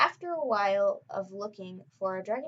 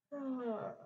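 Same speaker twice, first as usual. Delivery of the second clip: soft sigh; 4 semitones higher, 7 dB quieter than usual. Acted. Waking at dawn.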